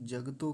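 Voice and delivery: 'जगतो' as jag-to; two quick syllables for 'जगतो'; half ग. Shown as a demonstration. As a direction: drop the vowel after ग in 'जगतो'. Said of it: "हम उनको आधा बोलते हैं".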